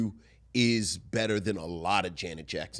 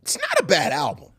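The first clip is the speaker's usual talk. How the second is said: raised, high-pitched insistence; emphatic protest; 13 semitones higher, 5 dB louder than usual. Real, not acted. Defending the album to a sceptical co-host, emphatic protest. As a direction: protest it loudly, voice jumping up.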